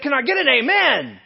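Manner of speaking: loud, high plea to the crowd; animated, slightly frustrated. Retold or imitated. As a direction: call out for a response, imitating a worked-up preacher. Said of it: "he was a little frustrated".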